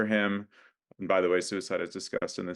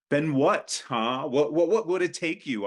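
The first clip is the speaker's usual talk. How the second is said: higher and louder, pressing for an answer; drunken pushing; 8 semitones higher, 5 dB louder than usual. Acted. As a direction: push him hard, half drunk. Drunk Ruben pushes Alonzo.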